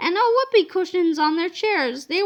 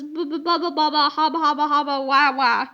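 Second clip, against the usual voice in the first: babbling nonsense; 2 semitones lower than usual. A narrator's reading. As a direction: stammer helplessly like a stunned man.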